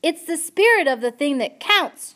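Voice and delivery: loud high repeat for a deaf listener; shouting. A narrator's reading. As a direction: shout every word clearly. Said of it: "shouted Pea".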